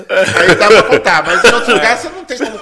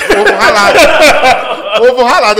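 laughing; 3 semitones higher, 8 dB louder than usual. Real, not acted. Laughing at friend's plate.

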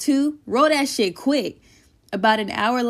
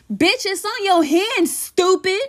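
high, teasing put-down; high mocking jab; 10 semitones higher, 3 dB louder than usual. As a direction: tease her up high. Mocking friend's mistake.

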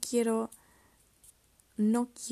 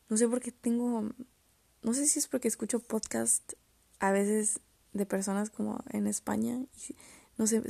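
normal talking voice

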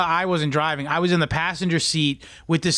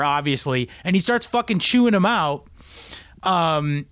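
ordinary talk, nothing marked

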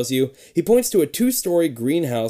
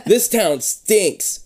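slow, drawn-out opener, higher; dramatic reading; 6 semitones higher, much slower than usual. Acted. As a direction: read it like a spooky book intro. Dramatic story opening.

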